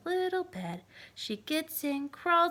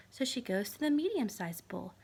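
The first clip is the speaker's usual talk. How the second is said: plain reading voice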